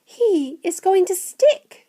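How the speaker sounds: put-on high, slow boy voice; high-pitched, drawn-out worry; anxious Charlie. A narrator's reading. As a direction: predict it slowly in a child's voice.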